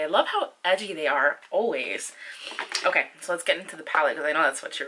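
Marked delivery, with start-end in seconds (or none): none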